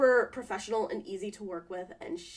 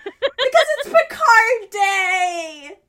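high, drawn-out celebratory cheer; excited shout; 11 semitones higher, 14 dB louder, much slower than usual. Real, not acted. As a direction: shout it like a long-awaited holiday. Cheering the show's big day.

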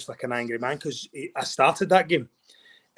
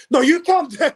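high and loud, half-mock irritated retort; snapping back; 14 semitones higher, 7 dB louder than usual. Husband snapping at his wife during the match, mock angry. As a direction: throw "calm" back at her, hammed-up annoyance. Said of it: "I'm like".

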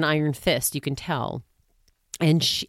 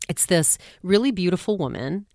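ordinary talk, nothing marked

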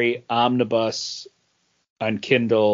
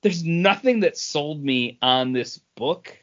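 ordinary delivery

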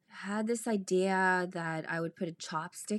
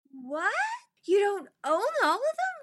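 mock astonishment; 13 semitones higher, 4 dB louder than usual. Retells her astonished question.

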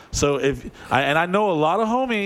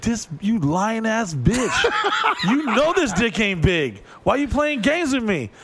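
higher, laughing indignant rant; mock outrage; 7 semitones higher, slower than usual. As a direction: play up the fury, half laughing. Angry at lying partner.

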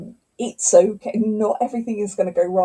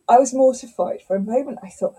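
normal talking voice

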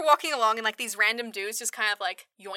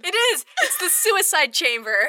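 excited; 7 semitones higher, 7 dB louder, slower than usual. Real, not acted. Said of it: "excited confirmation".

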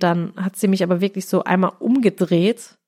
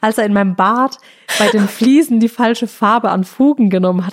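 ordinary delivery

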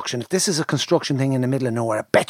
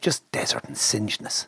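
whisper; 4 semitones lower, 7 dB quieter than usual. Acted. Savouring the bleak desert image.

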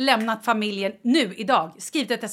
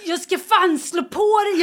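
higher, louder, slow threat; mock threat; 7 semitones higher, 6 dB louder, slower than usual. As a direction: growl it out half joking. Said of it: "Jag bara". (spelat hot mot Kalle, högre och långsamt)

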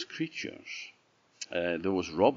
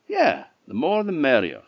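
cheerful; 7 semitones higher, 6 dB louder than usual. Acted. Chak answers cheerfully.

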